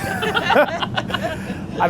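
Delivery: laughing. Real, not acted. Fumbling the Italian accent bit.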